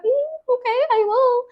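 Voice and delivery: very high, sing-song fake agreement. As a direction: humour them while hiding the secret. Pretending to agree while keeping secret.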